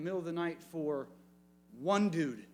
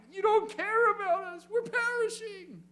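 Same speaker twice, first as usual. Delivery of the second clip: very high, loud, desperate mock cry; crying out in panic; 4 dB louder than usual. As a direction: shriek it like drowning men. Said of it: "they're crying".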